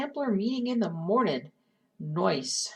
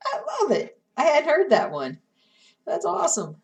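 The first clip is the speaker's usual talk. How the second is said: delighted laugh; 8 semitones higher, 7 dB louder than usual. Real, not acted. Delighted by a viewer's pun.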